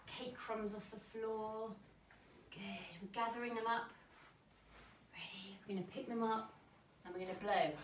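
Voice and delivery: gentle guided stretching and breathing; a lightly soft teacher guiding a cool down; calm cool-down voice. Acted. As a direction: coax them through the stretch softly. Leads a cool down.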